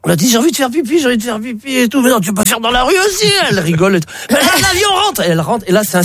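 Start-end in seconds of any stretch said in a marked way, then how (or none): 0.3-3.3 high-pitched mock tipsy women; tipsy girlfriends. Tipsy friend tells the other she needs to pee, high-pitched. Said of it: "elles sont bourrées". 4.3-5.2 high-pitched mock tipsy women; tipsy girlfriends. Tipsy friend tells the other she needs to pee, high-pitched. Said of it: "elles sont bourrées".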